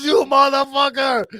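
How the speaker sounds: high, loud, slow outraged yell; furious yell. Retold or imitated. Gas station owner catching the pranksters, furious yell. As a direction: explode with recognition. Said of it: "He goes".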